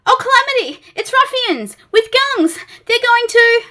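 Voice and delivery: high, loud, fussy terror; a nervous little creature wailing in alarm; panicky Mister Jelly. Acted.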